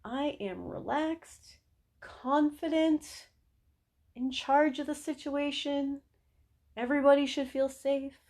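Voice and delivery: unhurried and assured, phrase by phrase; calm confidence. Acted. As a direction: speak as the one calmly in charge. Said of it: "Also tells them".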